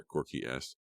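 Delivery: ordinary delivery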